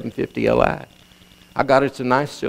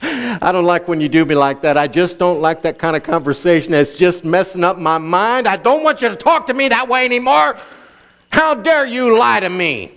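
indignant rebuke; 4 semitones higher than usual. Acted. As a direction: act out mounting outrage. Acted quote of Dan's offended rebuke.

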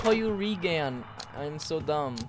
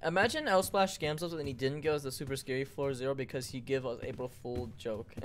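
ordinary talk, nothing marked

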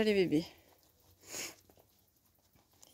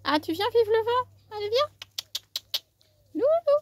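calling a dog; 9 semitones higher, 3 dB louder than usual. Real, not acted.

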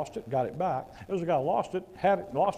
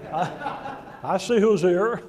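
real laugh through the words, slow and louder; 2 semitones higher, 5 dB louder, much slower than usual. Real, not acted. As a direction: chuckle it out at the crowd. Laughing at the crowd.

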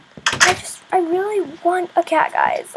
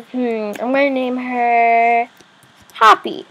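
ordinary talk, nothing marked

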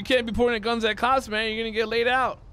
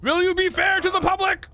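drill sergeant questioning; 7 semitones higher, 3 dB louder than usual. Acted. Mock military oath questions.

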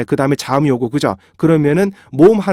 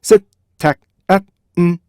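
셋, 택, 앳, 음, 제 as five separate beats; staccato syllable-by-syllable demo; syllables split apart; 3 semitones higher than usual. Shown as a demonstration. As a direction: break it into five evenly timed syllables. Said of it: "딱딱딱 음절을 정확하게 분리".

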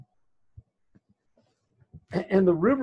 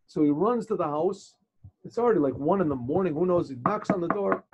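ordinary delivery

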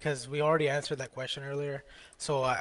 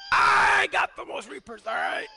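very high, drawn-out yell; insistent shouted boast; slower than usual. Real, not acted. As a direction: stretch the brag out way up high. Repeats boast over squabbling teammates.

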